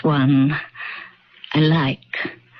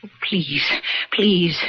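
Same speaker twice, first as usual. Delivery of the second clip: lower, sobbing plea; begging; 4 semitones lower than usual. Acted. Old woman begging her younger self. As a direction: break into a weeping plea.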